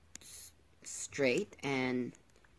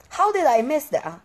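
high startled outburst; 11 semitones higher, 10 dB louder than usual. Real, not acted. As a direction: blurt it out in shock. Startled by spiders.

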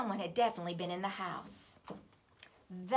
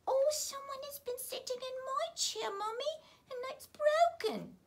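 much higher, tearful little bear; crying Baby Bear. A narrator's reading. Baby Bear crying to his mommy over his chair. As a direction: wail it like a little cub. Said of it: "he began to cry".

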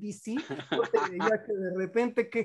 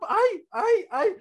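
mock squeal; 11 semitones higher, 4 dB louder, slower than usual. Overwhelmed host squeals.

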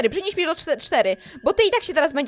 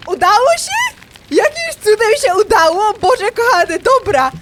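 higher, louder astonished cry; can't believe it worked; amazed relief; 4 semitones higher, 6 dB louder than usual. Real, not acted. After escaping, crying out in astonished relief.

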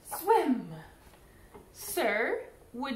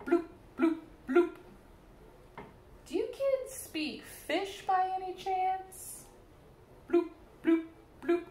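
bubble talk; 7 semitones higher, 4 dB quieter than usual. Acted. Fish voices bubbling sounds.

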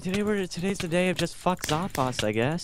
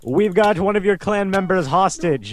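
raised, commanding announcer voice; mock hostage announcement; 3 semitones higher, 3 dB louder than usual. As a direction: proclaim the demands like a kidnapper.

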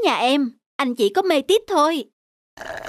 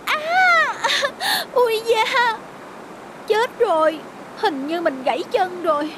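pain cries; 8 semitones higher, slower than usual. Acted. Falls, breaks leg in rain.

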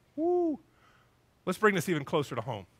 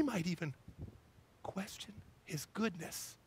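whisper; 13 dB quieter than usual. Real, not acted. Preacher whispering to the congregation. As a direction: drop to a whisper to make it land. Preacher's hushed dramatic point.

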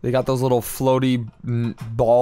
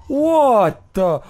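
high, loud startled cry; 11 semitones higher, 7 dB louder than usual. Real, not acted. Gamer hit by an unseen attacker, startled. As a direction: burst out in sudden shock. Gamer suddenly shot in game.

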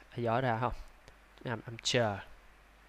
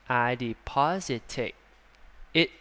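slow, each word of I deposited it in the bank separated; slow and clear; slower than usual. Shown as a demonstration. Teacher slowly stressing the small word it. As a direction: make the it clearly heard. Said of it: "Phải rõ cái cụm này ra nha".

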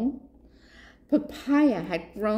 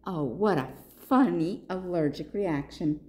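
usual speaking voice

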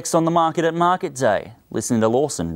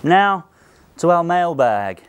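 ordinary talk

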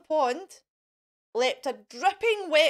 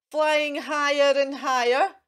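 plain reading voice

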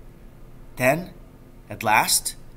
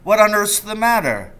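higher, louder worried father; alarmed father; 9 semitones higher, 6 dB louder than usual. A narrator's reading. Father, finding his son awake at night, asks urgently and higher. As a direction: ask the boy urgently what happened.

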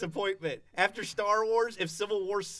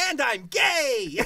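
slow, louder deadpan hero line; mock Spider-Man; 3 semitones higher, 5 dB louder, much slower than usual. Friends mocking the R-rated Spider-Man, slow hammy. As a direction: drag it out like a parody.

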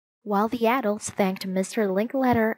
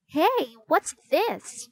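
put-on higher-pitched, curious child's question; curious discovery; 7 semitones higher, slower than usual. A narrator's reading. Ruth Rose finds a clue on the doorframe, curious discovery. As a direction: pipe up higher on spotting something odd.

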